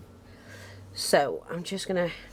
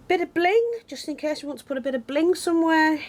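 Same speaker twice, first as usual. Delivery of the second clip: much higher, playful voice for the bling; playful sing-song bling; 12 semitones higher than usual. Pretend. Crafter teasing viewers about adding sparkle, high and playful. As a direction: make the bling sound like a treat.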